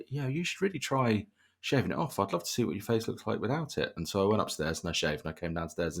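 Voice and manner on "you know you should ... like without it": lightly voiced wife, pitched up then easing down; higher-pitched quote of a partner's advice; retold wife's suggestion. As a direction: imitate a wife's coaxing suggestion, higher at the start. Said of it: "she once said to me".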